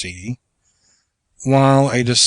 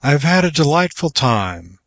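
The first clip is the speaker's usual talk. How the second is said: formal tea farewell; 4 semitones higher than usual. Shown as a demonstration. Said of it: "spoken at the termination of a formal tea".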